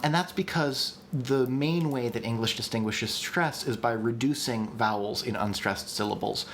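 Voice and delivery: normal talking voice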